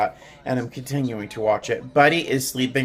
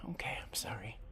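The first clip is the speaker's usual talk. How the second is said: very soft unvoiced apology; whisper; 2 semitones lower, 22 dB quieter than usual. Real, not acted. Owner whispering sorry to her cat, whisper. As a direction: breathe a tiny sorry.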